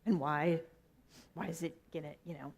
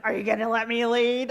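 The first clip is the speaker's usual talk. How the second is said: imitating Roland; 3 semitones higher, 9 dB louder than usual. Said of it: "Roland's like".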